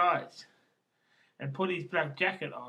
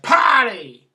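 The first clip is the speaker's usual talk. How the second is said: big loud cry of "party"; sudden shouted burst; exuberant shout; 5 semitones higher, 14 dB louder than usual. Acted. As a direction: belt out "party", high then falling. Exclaims party in his poem.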